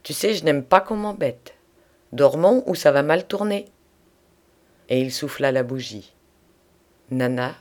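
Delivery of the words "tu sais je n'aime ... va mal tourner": acted threat with raised pitch; brusque, higher-pitched ultimatum; brutal voice. A narrator's reading. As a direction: warn forcefully, at the end of patience. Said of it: "d'une voix brutale".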